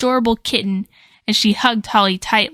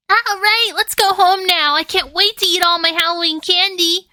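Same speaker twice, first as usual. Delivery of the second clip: put-on much higher, happy squealing girl; joyful squeal; 10 semitones higher than usual. A narrator's reading. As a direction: squeal with delight at the candy. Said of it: "Melissa squealed in utter joy".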